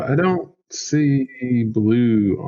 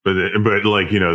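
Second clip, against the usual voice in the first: usual speaking voice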